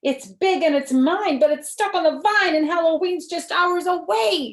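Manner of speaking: the witch insisting it's hers; a hammed-up storybook witch. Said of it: "said the witch".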